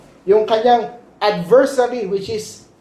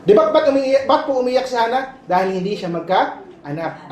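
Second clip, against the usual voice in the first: ordinary talk, nothing marked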